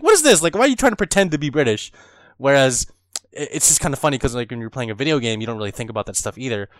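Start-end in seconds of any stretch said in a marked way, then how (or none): 0.0-1.9 higher, louder mock indignation; indignant disbelief. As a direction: imitate an affronted native listener. Voicing British people hearing fake accent.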